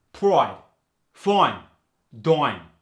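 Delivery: "pride, fine, dime" loud with oi; long I as oi. Shown as a demonstration. Teacher demos the New York oi for long I. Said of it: "switched to the oi sound".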